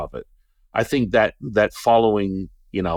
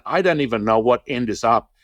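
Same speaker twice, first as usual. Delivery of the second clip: normal talking voice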